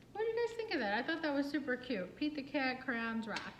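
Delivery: ordinary delivery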